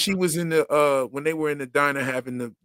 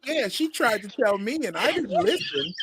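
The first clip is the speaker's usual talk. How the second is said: mock whiny excuse; 6 semitones higher, 4 dB quieter than usual. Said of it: "She was like".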